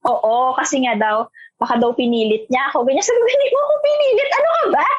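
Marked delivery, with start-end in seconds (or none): 3.3-4.8 high, exasperated reassuring; exasperated reassurance. Retold or imitated. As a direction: brush his guilt off, higher. Reassuring crying boyfriend, retold.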